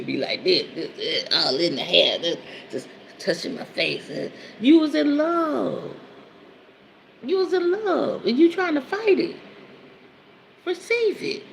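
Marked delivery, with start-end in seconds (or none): 4.6-11.4 teasing. Retold or imitated. Teasing her lovesick man, high sing-song. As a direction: coo at him like a lover.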